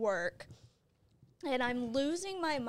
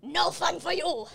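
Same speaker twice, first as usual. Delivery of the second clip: louder, hammed-up Russian accent as Putin; a failed Russian accent, louder; bad Russian accent; 5 semitones higher, 5 dB louder than usual. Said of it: "That was not a Russian accent at all".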